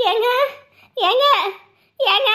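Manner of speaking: loud, questioning hail towards a house; calling out. Acted. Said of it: "கூப்பிட்டு பாரு". (வீட்டு வாசலில் நின்று கூப்பிடும் குரல்)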